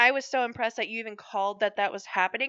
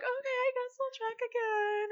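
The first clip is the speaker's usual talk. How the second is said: high, small, softer voice; imitating worried co-host; 12 semitones higher, 7 dB quieter than usual. Co-host agreeing to check again, imitated meek high voice. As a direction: reluctantly give in, high and soft. Imitating co-host's reluctant reply.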